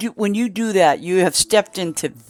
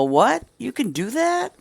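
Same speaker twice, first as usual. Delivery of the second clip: pitched-up mock amazement; surprised disbelief; 7 semitones higher than usual. Someone learning a new trick, high mock surprise. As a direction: gasp at the news with mock disbelief. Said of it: "then you'll go".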